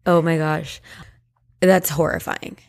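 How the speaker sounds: usual speaking voice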